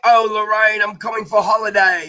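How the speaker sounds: hammed-up delight for Lorraine; mock gushing. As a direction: gush like a delighted fan. Australians gush over Lorraine.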